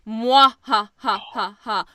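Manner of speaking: loud, slow, deliberate mwahaha; imitated villain laugh. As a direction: spell out each evil ha like a textbook villain. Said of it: "just sits there and goes".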